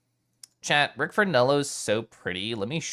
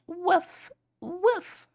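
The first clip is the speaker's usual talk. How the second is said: tiny, very high dog woof; small high-pitched pretend bark; playful woof. Streamer playing at being a dog, high little woofs.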